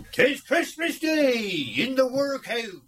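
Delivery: dramatic recitation. Acted. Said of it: "Go on then, please, Joe".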